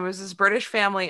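ordinary delivery

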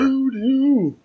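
high eerie mock chant of the word; spooky high voice. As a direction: say it like a spooky spell. Echoing the flavor name Voodoo.

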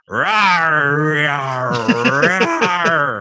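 loud roaring dinosaur through a megaphone. Retold or imitated. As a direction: roar it out like a T-Rex. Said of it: "Steven Spielberg would have a megaphone".

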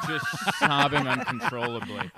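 cracking up at his own joke; real laughter while describing crying audiences; laughing. Real, not acted.

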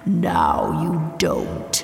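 slow, drawn-out villain voice; slow sinister taunt. Acted. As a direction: savour each word as you vanish. Demon taunts heroine, then vanishes.

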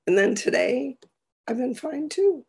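hoarse laryngitis voice; hoarse, raspy from laryngitis; frog voice. Real, not acted. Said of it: "frog voice".

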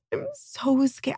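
high, soft and slow, a tearful confession; small frightened voice on the edge of tears; teary scared. Retold or imitated. Mom telling the nurse before her C-section, tearful and scared. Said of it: "I had, like, tears in my eyes".

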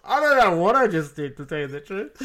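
embarrassed laugh through the words. Real, not acted. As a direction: laugh while admitting it. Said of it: "English impersonation of an Australian".

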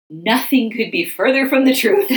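loud rueful laugh on the line. Real, not acted. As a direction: let a laugh burst out at the end. Ironic point, laugh heard.

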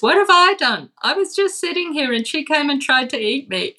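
high-pitched, put-upon whine; indignant, high whiny complaint; whiny demon. Acted. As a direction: whine in protest like a wronged little demon. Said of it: "talks back in a really whiny way".

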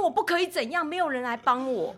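high, loud exasperated retort; indignant protest. Retold or imitated. Mother protesting to the principal, high and exasperated. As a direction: throw it back in frustration. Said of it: "我说".

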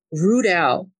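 ordinary talk

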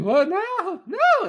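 very high mock wail of disbelief; mock panic, pitch shooting way up; freaking out. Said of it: "he's just freaking out".

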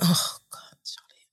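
whispered groan of dismay; breathless, under the breath despair. Retold or imitated. Speaker hearing a two-week deadline, whispered dismay. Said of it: "I'm like".

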